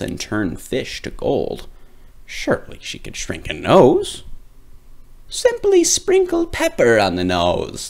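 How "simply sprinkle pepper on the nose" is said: high, crackly witch; high sly witch voice. Acted. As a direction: cackle out advice like a witch. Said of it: "the witch said".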